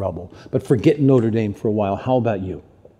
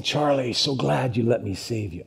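put-on softer Irish accent; Jesus; 6 dB quieter than usual. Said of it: "I put an Irish accent on Jesus".

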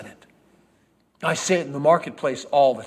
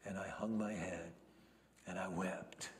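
grieving whisper; 7 semitones lower, 20 dB quieter than usual. Acted. Grieving at the cross.

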